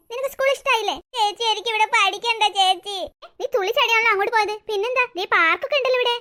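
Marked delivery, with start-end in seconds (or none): 1.2-3.0 slow, tearful plea to big sister; crying child. Acted. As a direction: whine through tears, dragging the words. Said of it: "നീ എന്തിനാ കരയുന്നേ". (കരഞ്ഞുകൊണ്ട് ചേച്ചിയോട് പരാതി)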